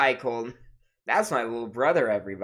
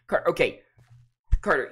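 usual speaking voice

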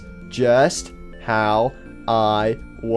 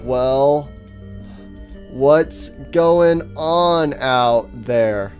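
very slow, drawn-out words; odd halting speech; much slower than usual. Acted. Faceless stranger excuses his way of talking, odd halting speech. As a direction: stretch and separate every single word. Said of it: "why do you talk like that?".

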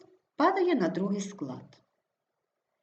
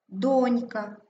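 Донька stressed on the first syllable, ДОНЬ-ка; 3 semitones higher, 3 dB louder than usual. Shown as a demonstration. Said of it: "Наголошений перший склад О". (Донька з наголосом на ДОНЬ)

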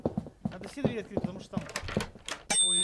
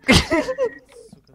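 high loud yelp; panicked yell; 17 dB louder than usual. Real, not acted. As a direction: yelp in fright. Monster jump. (испуганный вскрик)

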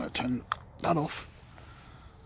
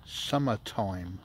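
summertime drawn out syllable by syllable; slow and clear; 4 semitones lower, much slower than usual. Shown as a demonstration. To the spirits, giving the word slowly. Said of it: "The second word".

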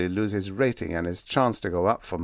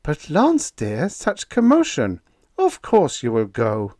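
much higher woman's voice, firm; calm and decisive; 6 semitones higher, 5 dB louder than usual. Acted. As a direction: settle it calmly and firmly. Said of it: "In a calm, decisive voice".

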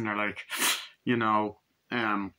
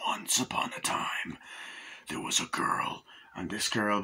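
slow, softer whispered storytelling; eerie whisper; 2 semitones lower, 7 dB quieter than usual. Retold or imitated. Reviewer imitates the clone mother's eerie story in a whisper. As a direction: hush it like a creepy bedtime tale.

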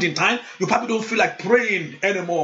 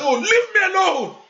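higher, peevish "leave me alone"; mock annoyed plea; exasperated brush-off; 12 semitones higher than usual. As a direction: push God away like a sulky child. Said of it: "sometimes I say".

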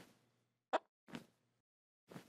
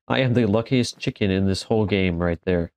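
ordinary delivery

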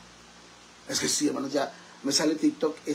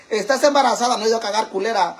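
mock loud snap; 6 semitones higher, 7 dB louder, faster than usual. Acted quarrel with friend.

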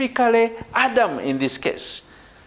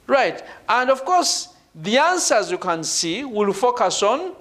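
ordinary talk

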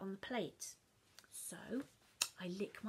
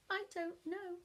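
higher feigned innocence; innocent child; 8 semitones higher than usual. Acted. Child to Mum, higher, innocent. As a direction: play dumb.

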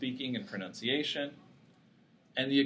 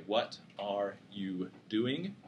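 slow, each word separated; carefully enunciated; 3 semitones lower, 6 dB quieter, much slower than usual. Shown as a demonstration. Lecturer demonstrating clear word separation, enunciated slowly. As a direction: say each word apart with tiny silences between. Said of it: "enunciating nicely, and you have nice separation".

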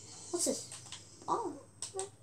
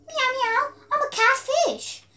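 silly cat voice; 10 semitones higher, slower than usual. Pretend. Playing the catfish.

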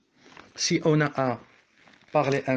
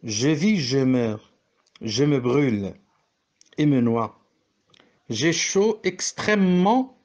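measured recital of Labé's verse, each phrase set apart; poem reading. Shown as a demonstration. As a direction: read the sonnet lines with pauses between them. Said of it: "c'est une lecture phonétique, celle de la poésie".

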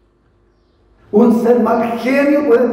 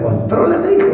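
teasing; 8 semitones higher than usual. Pretend.

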